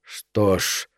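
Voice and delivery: low, soft resigned musing; inner thought. A narrator's reading. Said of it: "подумал он". (тихо, как мысль про себя)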